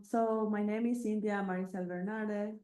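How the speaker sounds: usual speaking voice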